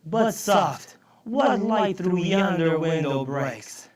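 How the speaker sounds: loud, drawn-out verse reading; reciting Shakespeare. Acted. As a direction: declaim the line of verse slowly. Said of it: "Here's a line from Romeo and Juliet".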